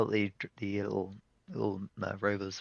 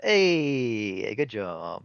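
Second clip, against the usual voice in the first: loud, drawn-out "hey" easing off; hearty congratulations; 7 dB louder, much slower than usual. Acted. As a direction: cheer them on, then settle. Captain congratulates the player.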